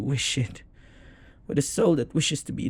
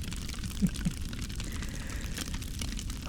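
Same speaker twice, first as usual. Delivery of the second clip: breathless, hushed amused laugh; a quiet laugh under the breath; soft laugh; 11 dB quieter than usual. Acted. Demon amused by the summoner's question, soft laugh.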